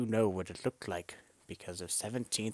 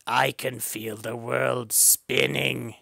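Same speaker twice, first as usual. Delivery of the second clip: louder, slow woozy voice; sway through it dizzily; loud, slow, dizzy bird voice; dizzy ostrich; 4 semitones higher, 8 dB louder, slower than usual. Acted. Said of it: "dizzy ostrich voiced".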